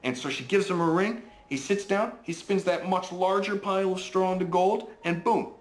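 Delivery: high, excited bedtime storyteller; animated story narration. A narrator's reading. Dad tells his child of the king and the gold, animated high storytelling. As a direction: tell it with wide-eyed, high-pitched wonder.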